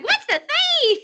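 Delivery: high, giggly Sweetie Pie voice; excited squeal. Acted. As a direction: squeal it out through a laugh, high up. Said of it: "how she'd be like".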